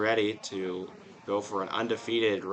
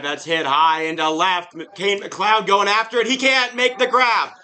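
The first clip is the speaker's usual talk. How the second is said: rising, louder home-run-style call; excited play-by-play call; 7 semitones higher, 6 dB louder than usual. Acted. Announcer calling a go-ahead double, excited and loud. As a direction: sell the big hit to the listeners.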